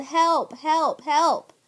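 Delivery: mock yelling help as she did at the church door; retold yell for help. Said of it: "I yelled".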